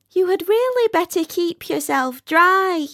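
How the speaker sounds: high, airy fussing voice; fire balloon voice. Acted. The fire balloon advising the weeping rocket, high and fussy. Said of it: "said the fire balloon".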